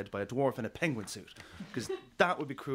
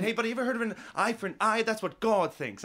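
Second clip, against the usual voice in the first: high, loud heckler's shout, fast then slowed on God thinks; retold shout; 9 semitones higher, 4 dB louder than usual. Big man being led away shouts at comedian, loud and high. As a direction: throw back the big man's yell from the aisle. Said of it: "he shouted out at me".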